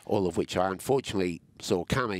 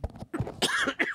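coughing fit. Real, not acted. Coughing fit mid-sentence.

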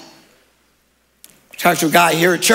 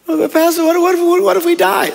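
higher, whiny fretting voice; mock worried congregant; 11 semitones higher, 3 dB louder than usual. Pastor mimicking a fearful churchgoer, high worried whine. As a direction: play the scared church member pleading.

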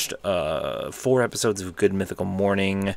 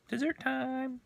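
excited; 4 dB quieter, slower than usual. Real, not acted. Announcing ice cream.

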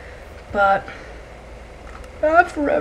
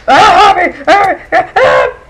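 loud frightened cries; panicked scream; 8 semitones higher, 11 dB louder, slower than usual. Real, not acted. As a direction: scream in alarm. Panicked under attack.

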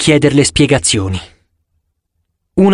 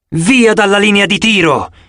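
raised-pitch warning call; shouted command; 6 semitones higher than usual. A narrator's reading. Huntress clears the line of fire, calling out. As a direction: call it out as an urgent order. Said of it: "gridò la ragazza con il cerchietto".

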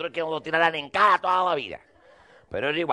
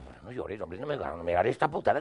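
much softer, pleading with a crying friend; soft coaxing; 8 semitones lower, 6 dB quieter than usual. Retold or imitated. To the crying notary, soft coaxing. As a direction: quietly soothe a weeping man. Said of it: "él me lloraba".

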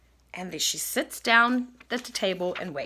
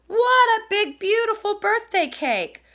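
high, thrilled storybook cub; excited little bear exclaiming; delighted awe; 12 semitones higher, 5 dB louder than usual. Acted. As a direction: marvel at it in a high voice. Little Bear admires the surprise cake.

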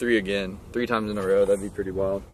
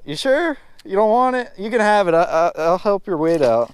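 higher teasing offer; mock generous; 7 semitones higher than usual. Real, not acted. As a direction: rub it in with fake kindness. Mock offering fish to rival.

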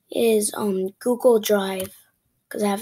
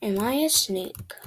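normal talking voice

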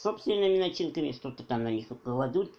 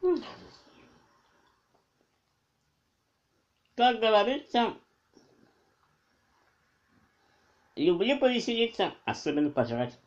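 words slurred around food; talking while chewing; 6 semitones higher than usual. Real, not acted. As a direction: mouth partly full of bread. Seen 2: Holds bitten bread; cheeks and lips show chewing throughout the words.

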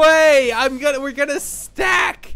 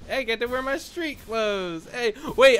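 ordinary talk, nothing marked

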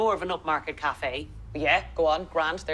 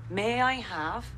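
slow, drawn-out phrase for repeating; feed it slowly, leaving it open to echo; teacher modelling; 3 semitones higher, slower than usual. Shown as a demonstration. Teacher prompts student Victor for a full sentence, slow modelling. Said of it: "I'm the teacher here".

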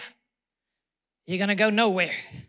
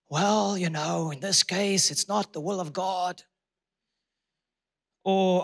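a hint of a limp, doubtful preacher; mock weak excuse; 4 semitones lower than usual. Preacher mocking a faithless answer, lightly doubtful. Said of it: "No, that wasn't Jesus' response".

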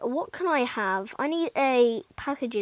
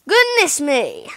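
louder, slow exclamation of dismay; exclaimed dismay; 2 semitones higher, 8 dB louder, slower than usual. Real, not acted. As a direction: call it out in disbelief. Reacting to money draining.

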